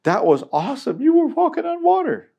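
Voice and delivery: high, loud, gushing praise; mock cheerful Jesus. Preacher jokingly voices a wrong Jesus, high and gushing. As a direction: gush like an excited cheerleader. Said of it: "No, that's not what Jesus said".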